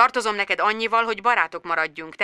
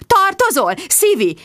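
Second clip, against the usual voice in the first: mocking echo; 12 semitones higher, 3 dB louder than usual. A narrator's reading. Bridget repeats Sharon's line.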